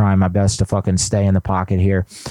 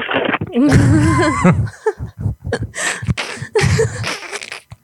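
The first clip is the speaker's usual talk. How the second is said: cackling wildly at the prank. Real, not acted. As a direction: burst into a long, high laugh. Laughs after the prank call.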